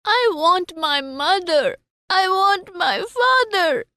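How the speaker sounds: high, sobbing cries rising to a shout. Acted. Child refusing sweets and balloons, sobbing for his parents. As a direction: sob and wail the names. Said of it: "He kept sobbing".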